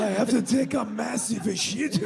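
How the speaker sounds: hammed-up Japanese accent with a groan; mock Japanese accent. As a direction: strain through a parody Japanese accent. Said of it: "we were doing, like, Japanese".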